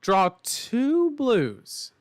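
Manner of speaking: high, loud, slow "two" stretched out; exasperated shout. Real, not acted. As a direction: yell "two blues" slowly in disbelief. Repeats the bad draw louder.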